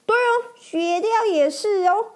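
chirpy sidekick agreeing, rushing the end; ferret voice. Acted. Ferret agrees with the knight about adventure, high and eager. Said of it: "雪貂也说".

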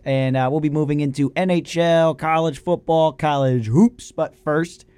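normal talking voice

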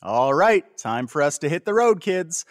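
loud, high cheery dad call; rally the kids like a dad. Retold or imitated. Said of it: "you're saying things like".

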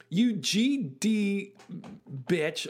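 slow, drawn-out mock drunken curse; a hint of a drunk yelling at her partner; drunken insult. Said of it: "boil their entire conflict down to".

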